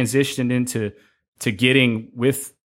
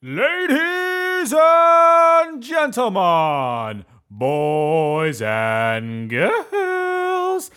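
booming drawn-out circus announcer; ringmaster; 10 semitones higher, much slower than usual. Acted. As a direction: call it out like a big-top ringmaster. Podcast host hypes intro.

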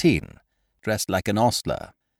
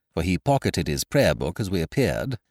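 plain reading voice

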